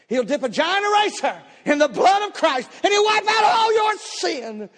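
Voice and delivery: high, slow shouted preacher cadence; preaching. Retold or imitated. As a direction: hammer it out like the pulpit. Preacher quoted at full force.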